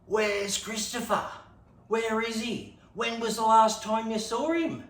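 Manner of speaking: hammed-up worried parents yelling; mock frantic parents. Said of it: "yelling at my brother".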